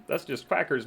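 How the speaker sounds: normal talking voice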